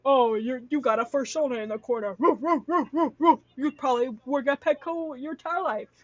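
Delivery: lightly mimicked heckler with loud woofing; mock taunt from the audience; mocking viewer. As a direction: imitate a jeering commenter, punching the woos loud. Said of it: "One of y'all being like".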